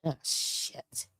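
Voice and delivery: real whispered curse under her breath; whispered oh shit. Real, not acted. Streamer whispering to herself after a screen mishap, dismayed. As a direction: hiss it to yourself, barely there.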